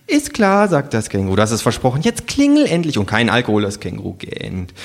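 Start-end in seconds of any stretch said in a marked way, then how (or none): 0.1-3.0 kangaroo. Acted. Kangaroo voiced in a story.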